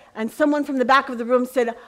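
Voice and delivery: ordinary talk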